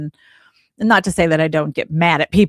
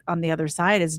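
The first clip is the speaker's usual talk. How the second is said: ordinary talk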